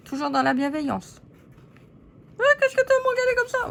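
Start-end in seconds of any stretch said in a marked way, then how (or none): 2.4-3.7 high quick doll voice picking a fight; tiny lady snapping at a glare; cheeky puppet challenge. Acted.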